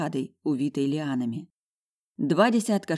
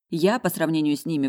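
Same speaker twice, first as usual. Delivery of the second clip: ordinary delivery, nothing marked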